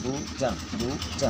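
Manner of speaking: बूजम repeated with long oo and a j; correct pronunciation. Shown as a demonstration. Teacher repeating the right reading for students, बूजम said "boo-jum". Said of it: "इसको बूजम पढ़ते हैं".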